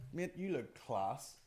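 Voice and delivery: quiet, slow and heartfelt; soft sincere compliment. Shown as a demonstration. Telling a friend he looks class, softly. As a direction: say it gently, meaning it.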